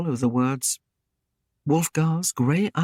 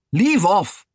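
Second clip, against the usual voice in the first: sharp retort; 5 semitones higher, 6 dB louder than usual. A narrator's reading. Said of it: "Wulfgar flung".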